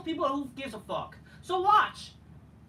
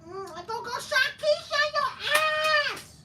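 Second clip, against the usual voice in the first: slow, much higher mock-diva threat; sassy threat; 11 semitones higher, 3 dB louder than usual. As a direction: drag out a hammed-up sassy warning.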